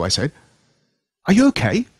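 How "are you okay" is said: high, loud concern; worried. Acted. Boy asking his bitten friend, worried. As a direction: ask it with real alarm. Narrator asks hurt friend.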